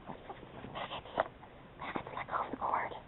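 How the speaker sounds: breathy whisper to the dog. Real, not acted. Whispering to the puppy chewing the cord, hushed. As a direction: whisper the dog's name to get him to let go.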